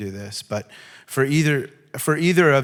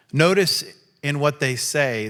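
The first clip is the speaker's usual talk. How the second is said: ordinary talk, nothing marked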